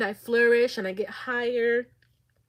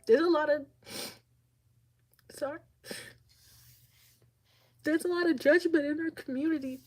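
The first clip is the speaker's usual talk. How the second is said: teary; 6 semitones higher than usual. Real, not acted. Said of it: "this is making me emotional".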